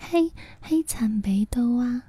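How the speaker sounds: hushed, breathy whisper. Real, not acted. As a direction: whisper it under your breath. Whispering aside on stream.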